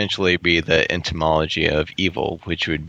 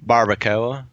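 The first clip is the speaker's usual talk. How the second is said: put-on accento del Sud; 3 semitones higher than usual. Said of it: "Southern accent saying barbacoa".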